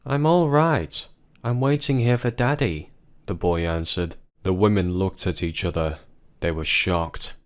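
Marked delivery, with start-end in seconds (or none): 0.1-2.9 put-on high young boy, calm and simple; a trusting child waiting for his father; boy's voice. Boy tells the women he waits for Daddy, high child's voice.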